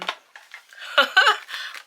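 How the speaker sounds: airy silent laugh, high pitched; breathless giggle; breathy laugh. Real, not acted. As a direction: wheeze out a laugh. Laughing at own mistake.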